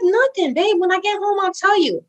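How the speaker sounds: high, sing-song fobbing off; retold brush-off. Wife puts off curious husband on phone, high-pitched brush-off. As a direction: brush him off in a high, innocent voice.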